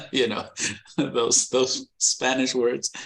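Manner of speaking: chuckling slowly through the words; amused, drawn-out laughing delivery. Real, not acted. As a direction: laugh at his own tiny Spanish vocabulary. Laughing about his few Spanish words.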